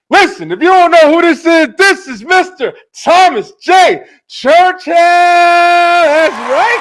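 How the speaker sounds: big, shouted, high-pitched guest introduction; hype announcer. Acted. Host introduces Mister Thomas J Churchill to viewers, hype announcer. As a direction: belt out the guest's name like a ring announcer, stretching it.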